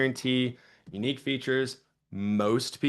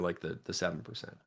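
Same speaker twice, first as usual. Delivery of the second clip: whisper; 5 semitones lower, 9 dB quieter than usual. Real, not acted. Aside in whisper.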